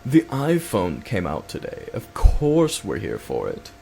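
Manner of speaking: slow, talking down, like it's obvious; slow smug duh-tone, as if everyone knows; condescending. Retold or imitated. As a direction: spell it out to a clueless customer. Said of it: "he said it to me like".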